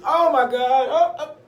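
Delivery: high, loud, hammed-up dismay; acted overwhelmed shock. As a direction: pile on the horror as a stunned listener. Acting staff overwhelmed by bad news.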